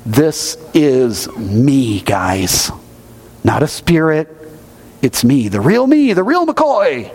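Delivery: slow, leaning hard on each word; emphatic. Retold or imitated. Pastor voicing Jesus insisting he's real, slow and emphatic. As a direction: insist it's really him. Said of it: "Jesus is being very emphatic".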